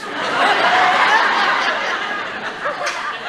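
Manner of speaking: long high-pitched laugh at own joke. Real, not acted. Comedian laughing at the cafe joke, high.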